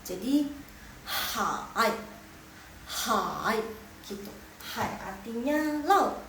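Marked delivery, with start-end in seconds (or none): 1.0-3.6 HAI drops low, then rises; third tone. Shown as a demonstration. Teacher modelling hai for students, dipping third tone. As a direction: slide HAI down and back up. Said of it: "turun gunung dulu, baru naik lagi".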